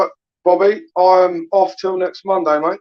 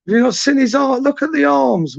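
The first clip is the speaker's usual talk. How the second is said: high-pitched, incredulous boast; can't believe you'd even ask; incredulous hype; 8 semitones higher than usual. Real, not acted. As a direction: talk him up in a raised pitch, as if it's obvious. Incredulous retort about Mister M's body.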